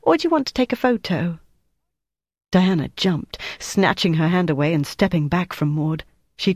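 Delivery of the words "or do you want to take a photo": fast, high, cheeky taunt; mock the onlooker with a grin in the voice; saucy. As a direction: Maud needling Flick, saucy and quick. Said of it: "she said saucily".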